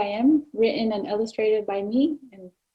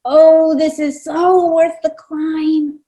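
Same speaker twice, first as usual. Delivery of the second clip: savoring; 5 semitones higher, 8 dB louder, slower than usual. Acted. Cockapoo savors his food.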